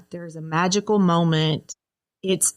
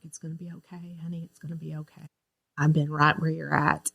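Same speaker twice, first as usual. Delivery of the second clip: much softer, hushed comfort; gentle reassurance; 2 semitones lower, 7 dB quieter than usual. Retold or imitated. Sober woman comforting her, softly reassuring. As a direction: soothe her like a friend.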